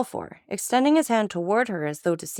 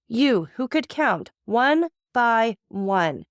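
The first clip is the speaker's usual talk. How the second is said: slow, higher, louder accusation; rising agitation; 5 semitones higher, 3 dB louder, much slower than usual. A narrator's reading. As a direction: drive each word home in fury. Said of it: "with increasing excitement".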